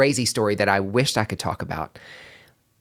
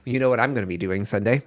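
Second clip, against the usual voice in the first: normal talking voice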